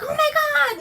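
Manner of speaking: high shocked squeal; mock shriek. Retelling the woman watching him hang, mock shriek. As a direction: squeal it like she just saw him jump. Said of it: "and she's like".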